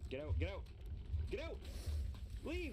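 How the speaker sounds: furious shout; angry shouting. Real, not acted. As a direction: yell get out at the crocodile. Angry shouting at crocodile in game.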